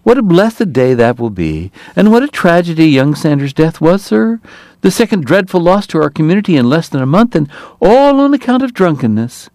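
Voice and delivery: oily politician in a piping voice; smooth high voice. Acted. Hoover's high voice continues.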